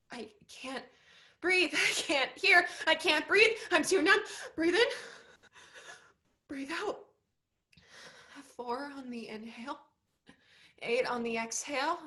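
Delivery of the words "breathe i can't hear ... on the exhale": much louder, high and frantic; panic attack. Acted. Molly alone on stage during a panic attack, frantic. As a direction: gasp the words out in rising panic. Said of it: "breathing of a panic attack".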